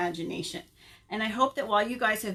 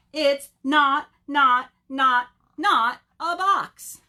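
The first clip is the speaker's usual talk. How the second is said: loud, high, drawn-out insistence; bunny loudly insisting it is not a box; emphatic insistence; 5 semitones higher, 9 dB louder, much slower than usual. Acted. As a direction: hammer each not like a stubborn bunny.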